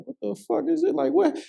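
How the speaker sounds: high-pitched panicked bewilderment; mock-panicked disbelief, high voice; retold panic. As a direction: replay the confused panic, pitch shooting way up. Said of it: "I'm panicking".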